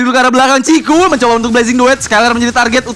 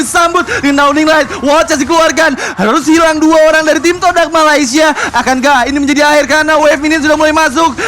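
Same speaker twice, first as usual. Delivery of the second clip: high, rising hype play-by-play; fighting game announcer; 6 semitones higher than usual. Acted. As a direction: call the fight, building higher. Caster hypes teamfight.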